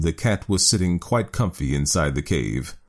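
usual speaking voice

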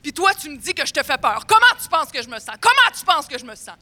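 high, loud offended man; menacing indignation. Retold or imitated. The man gripping her shoulders, angry and indignant. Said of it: "il me prend par les épaules puis il dit".

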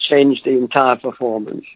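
ordinary talk

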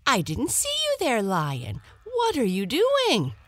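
high, quick, frightened little hare voice; small hare. A narrator's reading. Caught hare pleads with the lion, high and quick. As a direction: squeak it out fast and scared.

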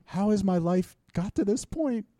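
mock despair. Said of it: "I go".

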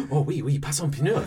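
laughing mock French crowd; crowd in French accent. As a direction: gasp it like an awed French mob. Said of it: "the crowd goes".

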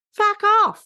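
high, punchy mock curse. Retelling the director's reply to the tribunal, a high mock curse. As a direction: spit out Fuck off high and sharp. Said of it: "turns around ... and go".

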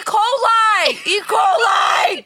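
loud, high-pitched shout; shouted outburst. Real, not acted. Co-host blurting a diagnosis at the storyteller, shouted outburst. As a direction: shout it out in disgusted alarm. Co-host reacts, horrified, to the mess.

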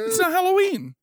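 high-pitched quote. Retold or imitated. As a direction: pitch it up like a cartoon. Repeating the quote higher.

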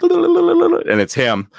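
drawn out very slowly and very high; dramatic reveal. Real, not acted. Telling the host the manager called back, a dramatic slow reveal. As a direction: stretch it out for the big reveal.

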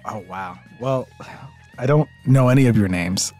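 ordinary delivery

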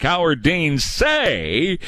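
"say" drawn out long and emphasized; stress on "say". Real, not acted. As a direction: lean hard on "say", stretching it slowly. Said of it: "I stress the word say on purpose".

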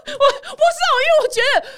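high, laughing through the words. Real, not acted. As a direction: still laughing, talk through it. Still laughing after joke.

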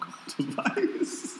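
words breaking up into a laugh; a breathy laugh while joking about the phone. Real, not acted. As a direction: laugh out the last word slowly. Laughing through the phrase.

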